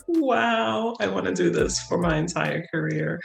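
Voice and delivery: awed excitement. Retold or imitated. Student nurse at her first birth, awed wow. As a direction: breathe it out in wonder. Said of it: "I was like".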